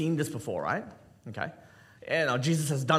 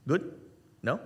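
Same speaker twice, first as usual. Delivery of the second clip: ordinary delivery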